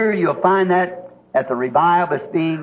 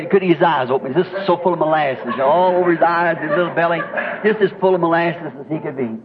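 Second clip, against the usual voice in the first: usual speaking voice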